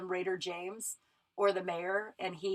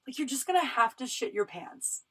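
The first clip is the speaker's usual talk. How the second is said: a higher, mock-encouraging voice to herself; pep talk to herself; 5 semitones higher than usual. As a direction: coax yourself like a sassy best friend. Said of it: "And I was like".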